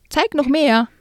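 high mocking call across the ice; taunting yell. Boy jeering at the fallen girl, high mocking yell. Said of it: "brüllte er".

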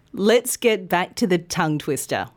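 ordinary talk

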